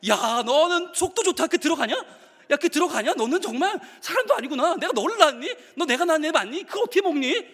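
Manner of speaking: high mock maternal outrage; berate, hamming up the disbelief; incredulous mother. Mother to the child eating dinner, incredulous scolding. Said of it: "이걸 먹는 날이 어떻게 됩니까".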